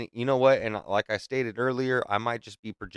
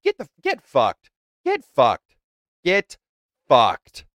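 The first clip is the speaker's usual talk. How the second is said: loud, higher, furious; angry outburst; 4 semitones higher, 8 dB louder, slower than usual. Real, not acted. Ranting at the president on the podcast, loud furious outburst. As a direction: spit it out in disgust.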